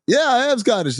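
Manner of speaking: high-pitched acted confirmation; retold eager reply. Stranger answers the storyteller at the bar, eager. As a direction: replay a stranger's keen answer.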